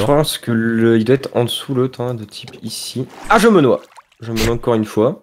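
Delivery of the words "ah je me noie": sudden loud alarm; alarmed cry. Real, not acted. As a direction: yelp as you run out of air. Drowning in the game. (cri d'alarme)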